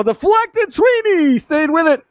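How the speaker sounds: high, stretched-out cry of excitement; excited save call. Real, not acted. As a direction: voice soars on the big save. Live call of deflected shot saved.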